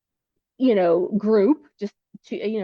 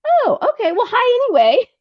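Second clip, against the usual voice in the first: high, louder embarrassed chirp; sheepish recovery; 13 semitones higher, 5 dB louder than usual. Retold or imitated. Corrected by the father, a high, bright, sheepish hi anyway. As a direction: brush off the mix-up brightly. Awkward after being corrected.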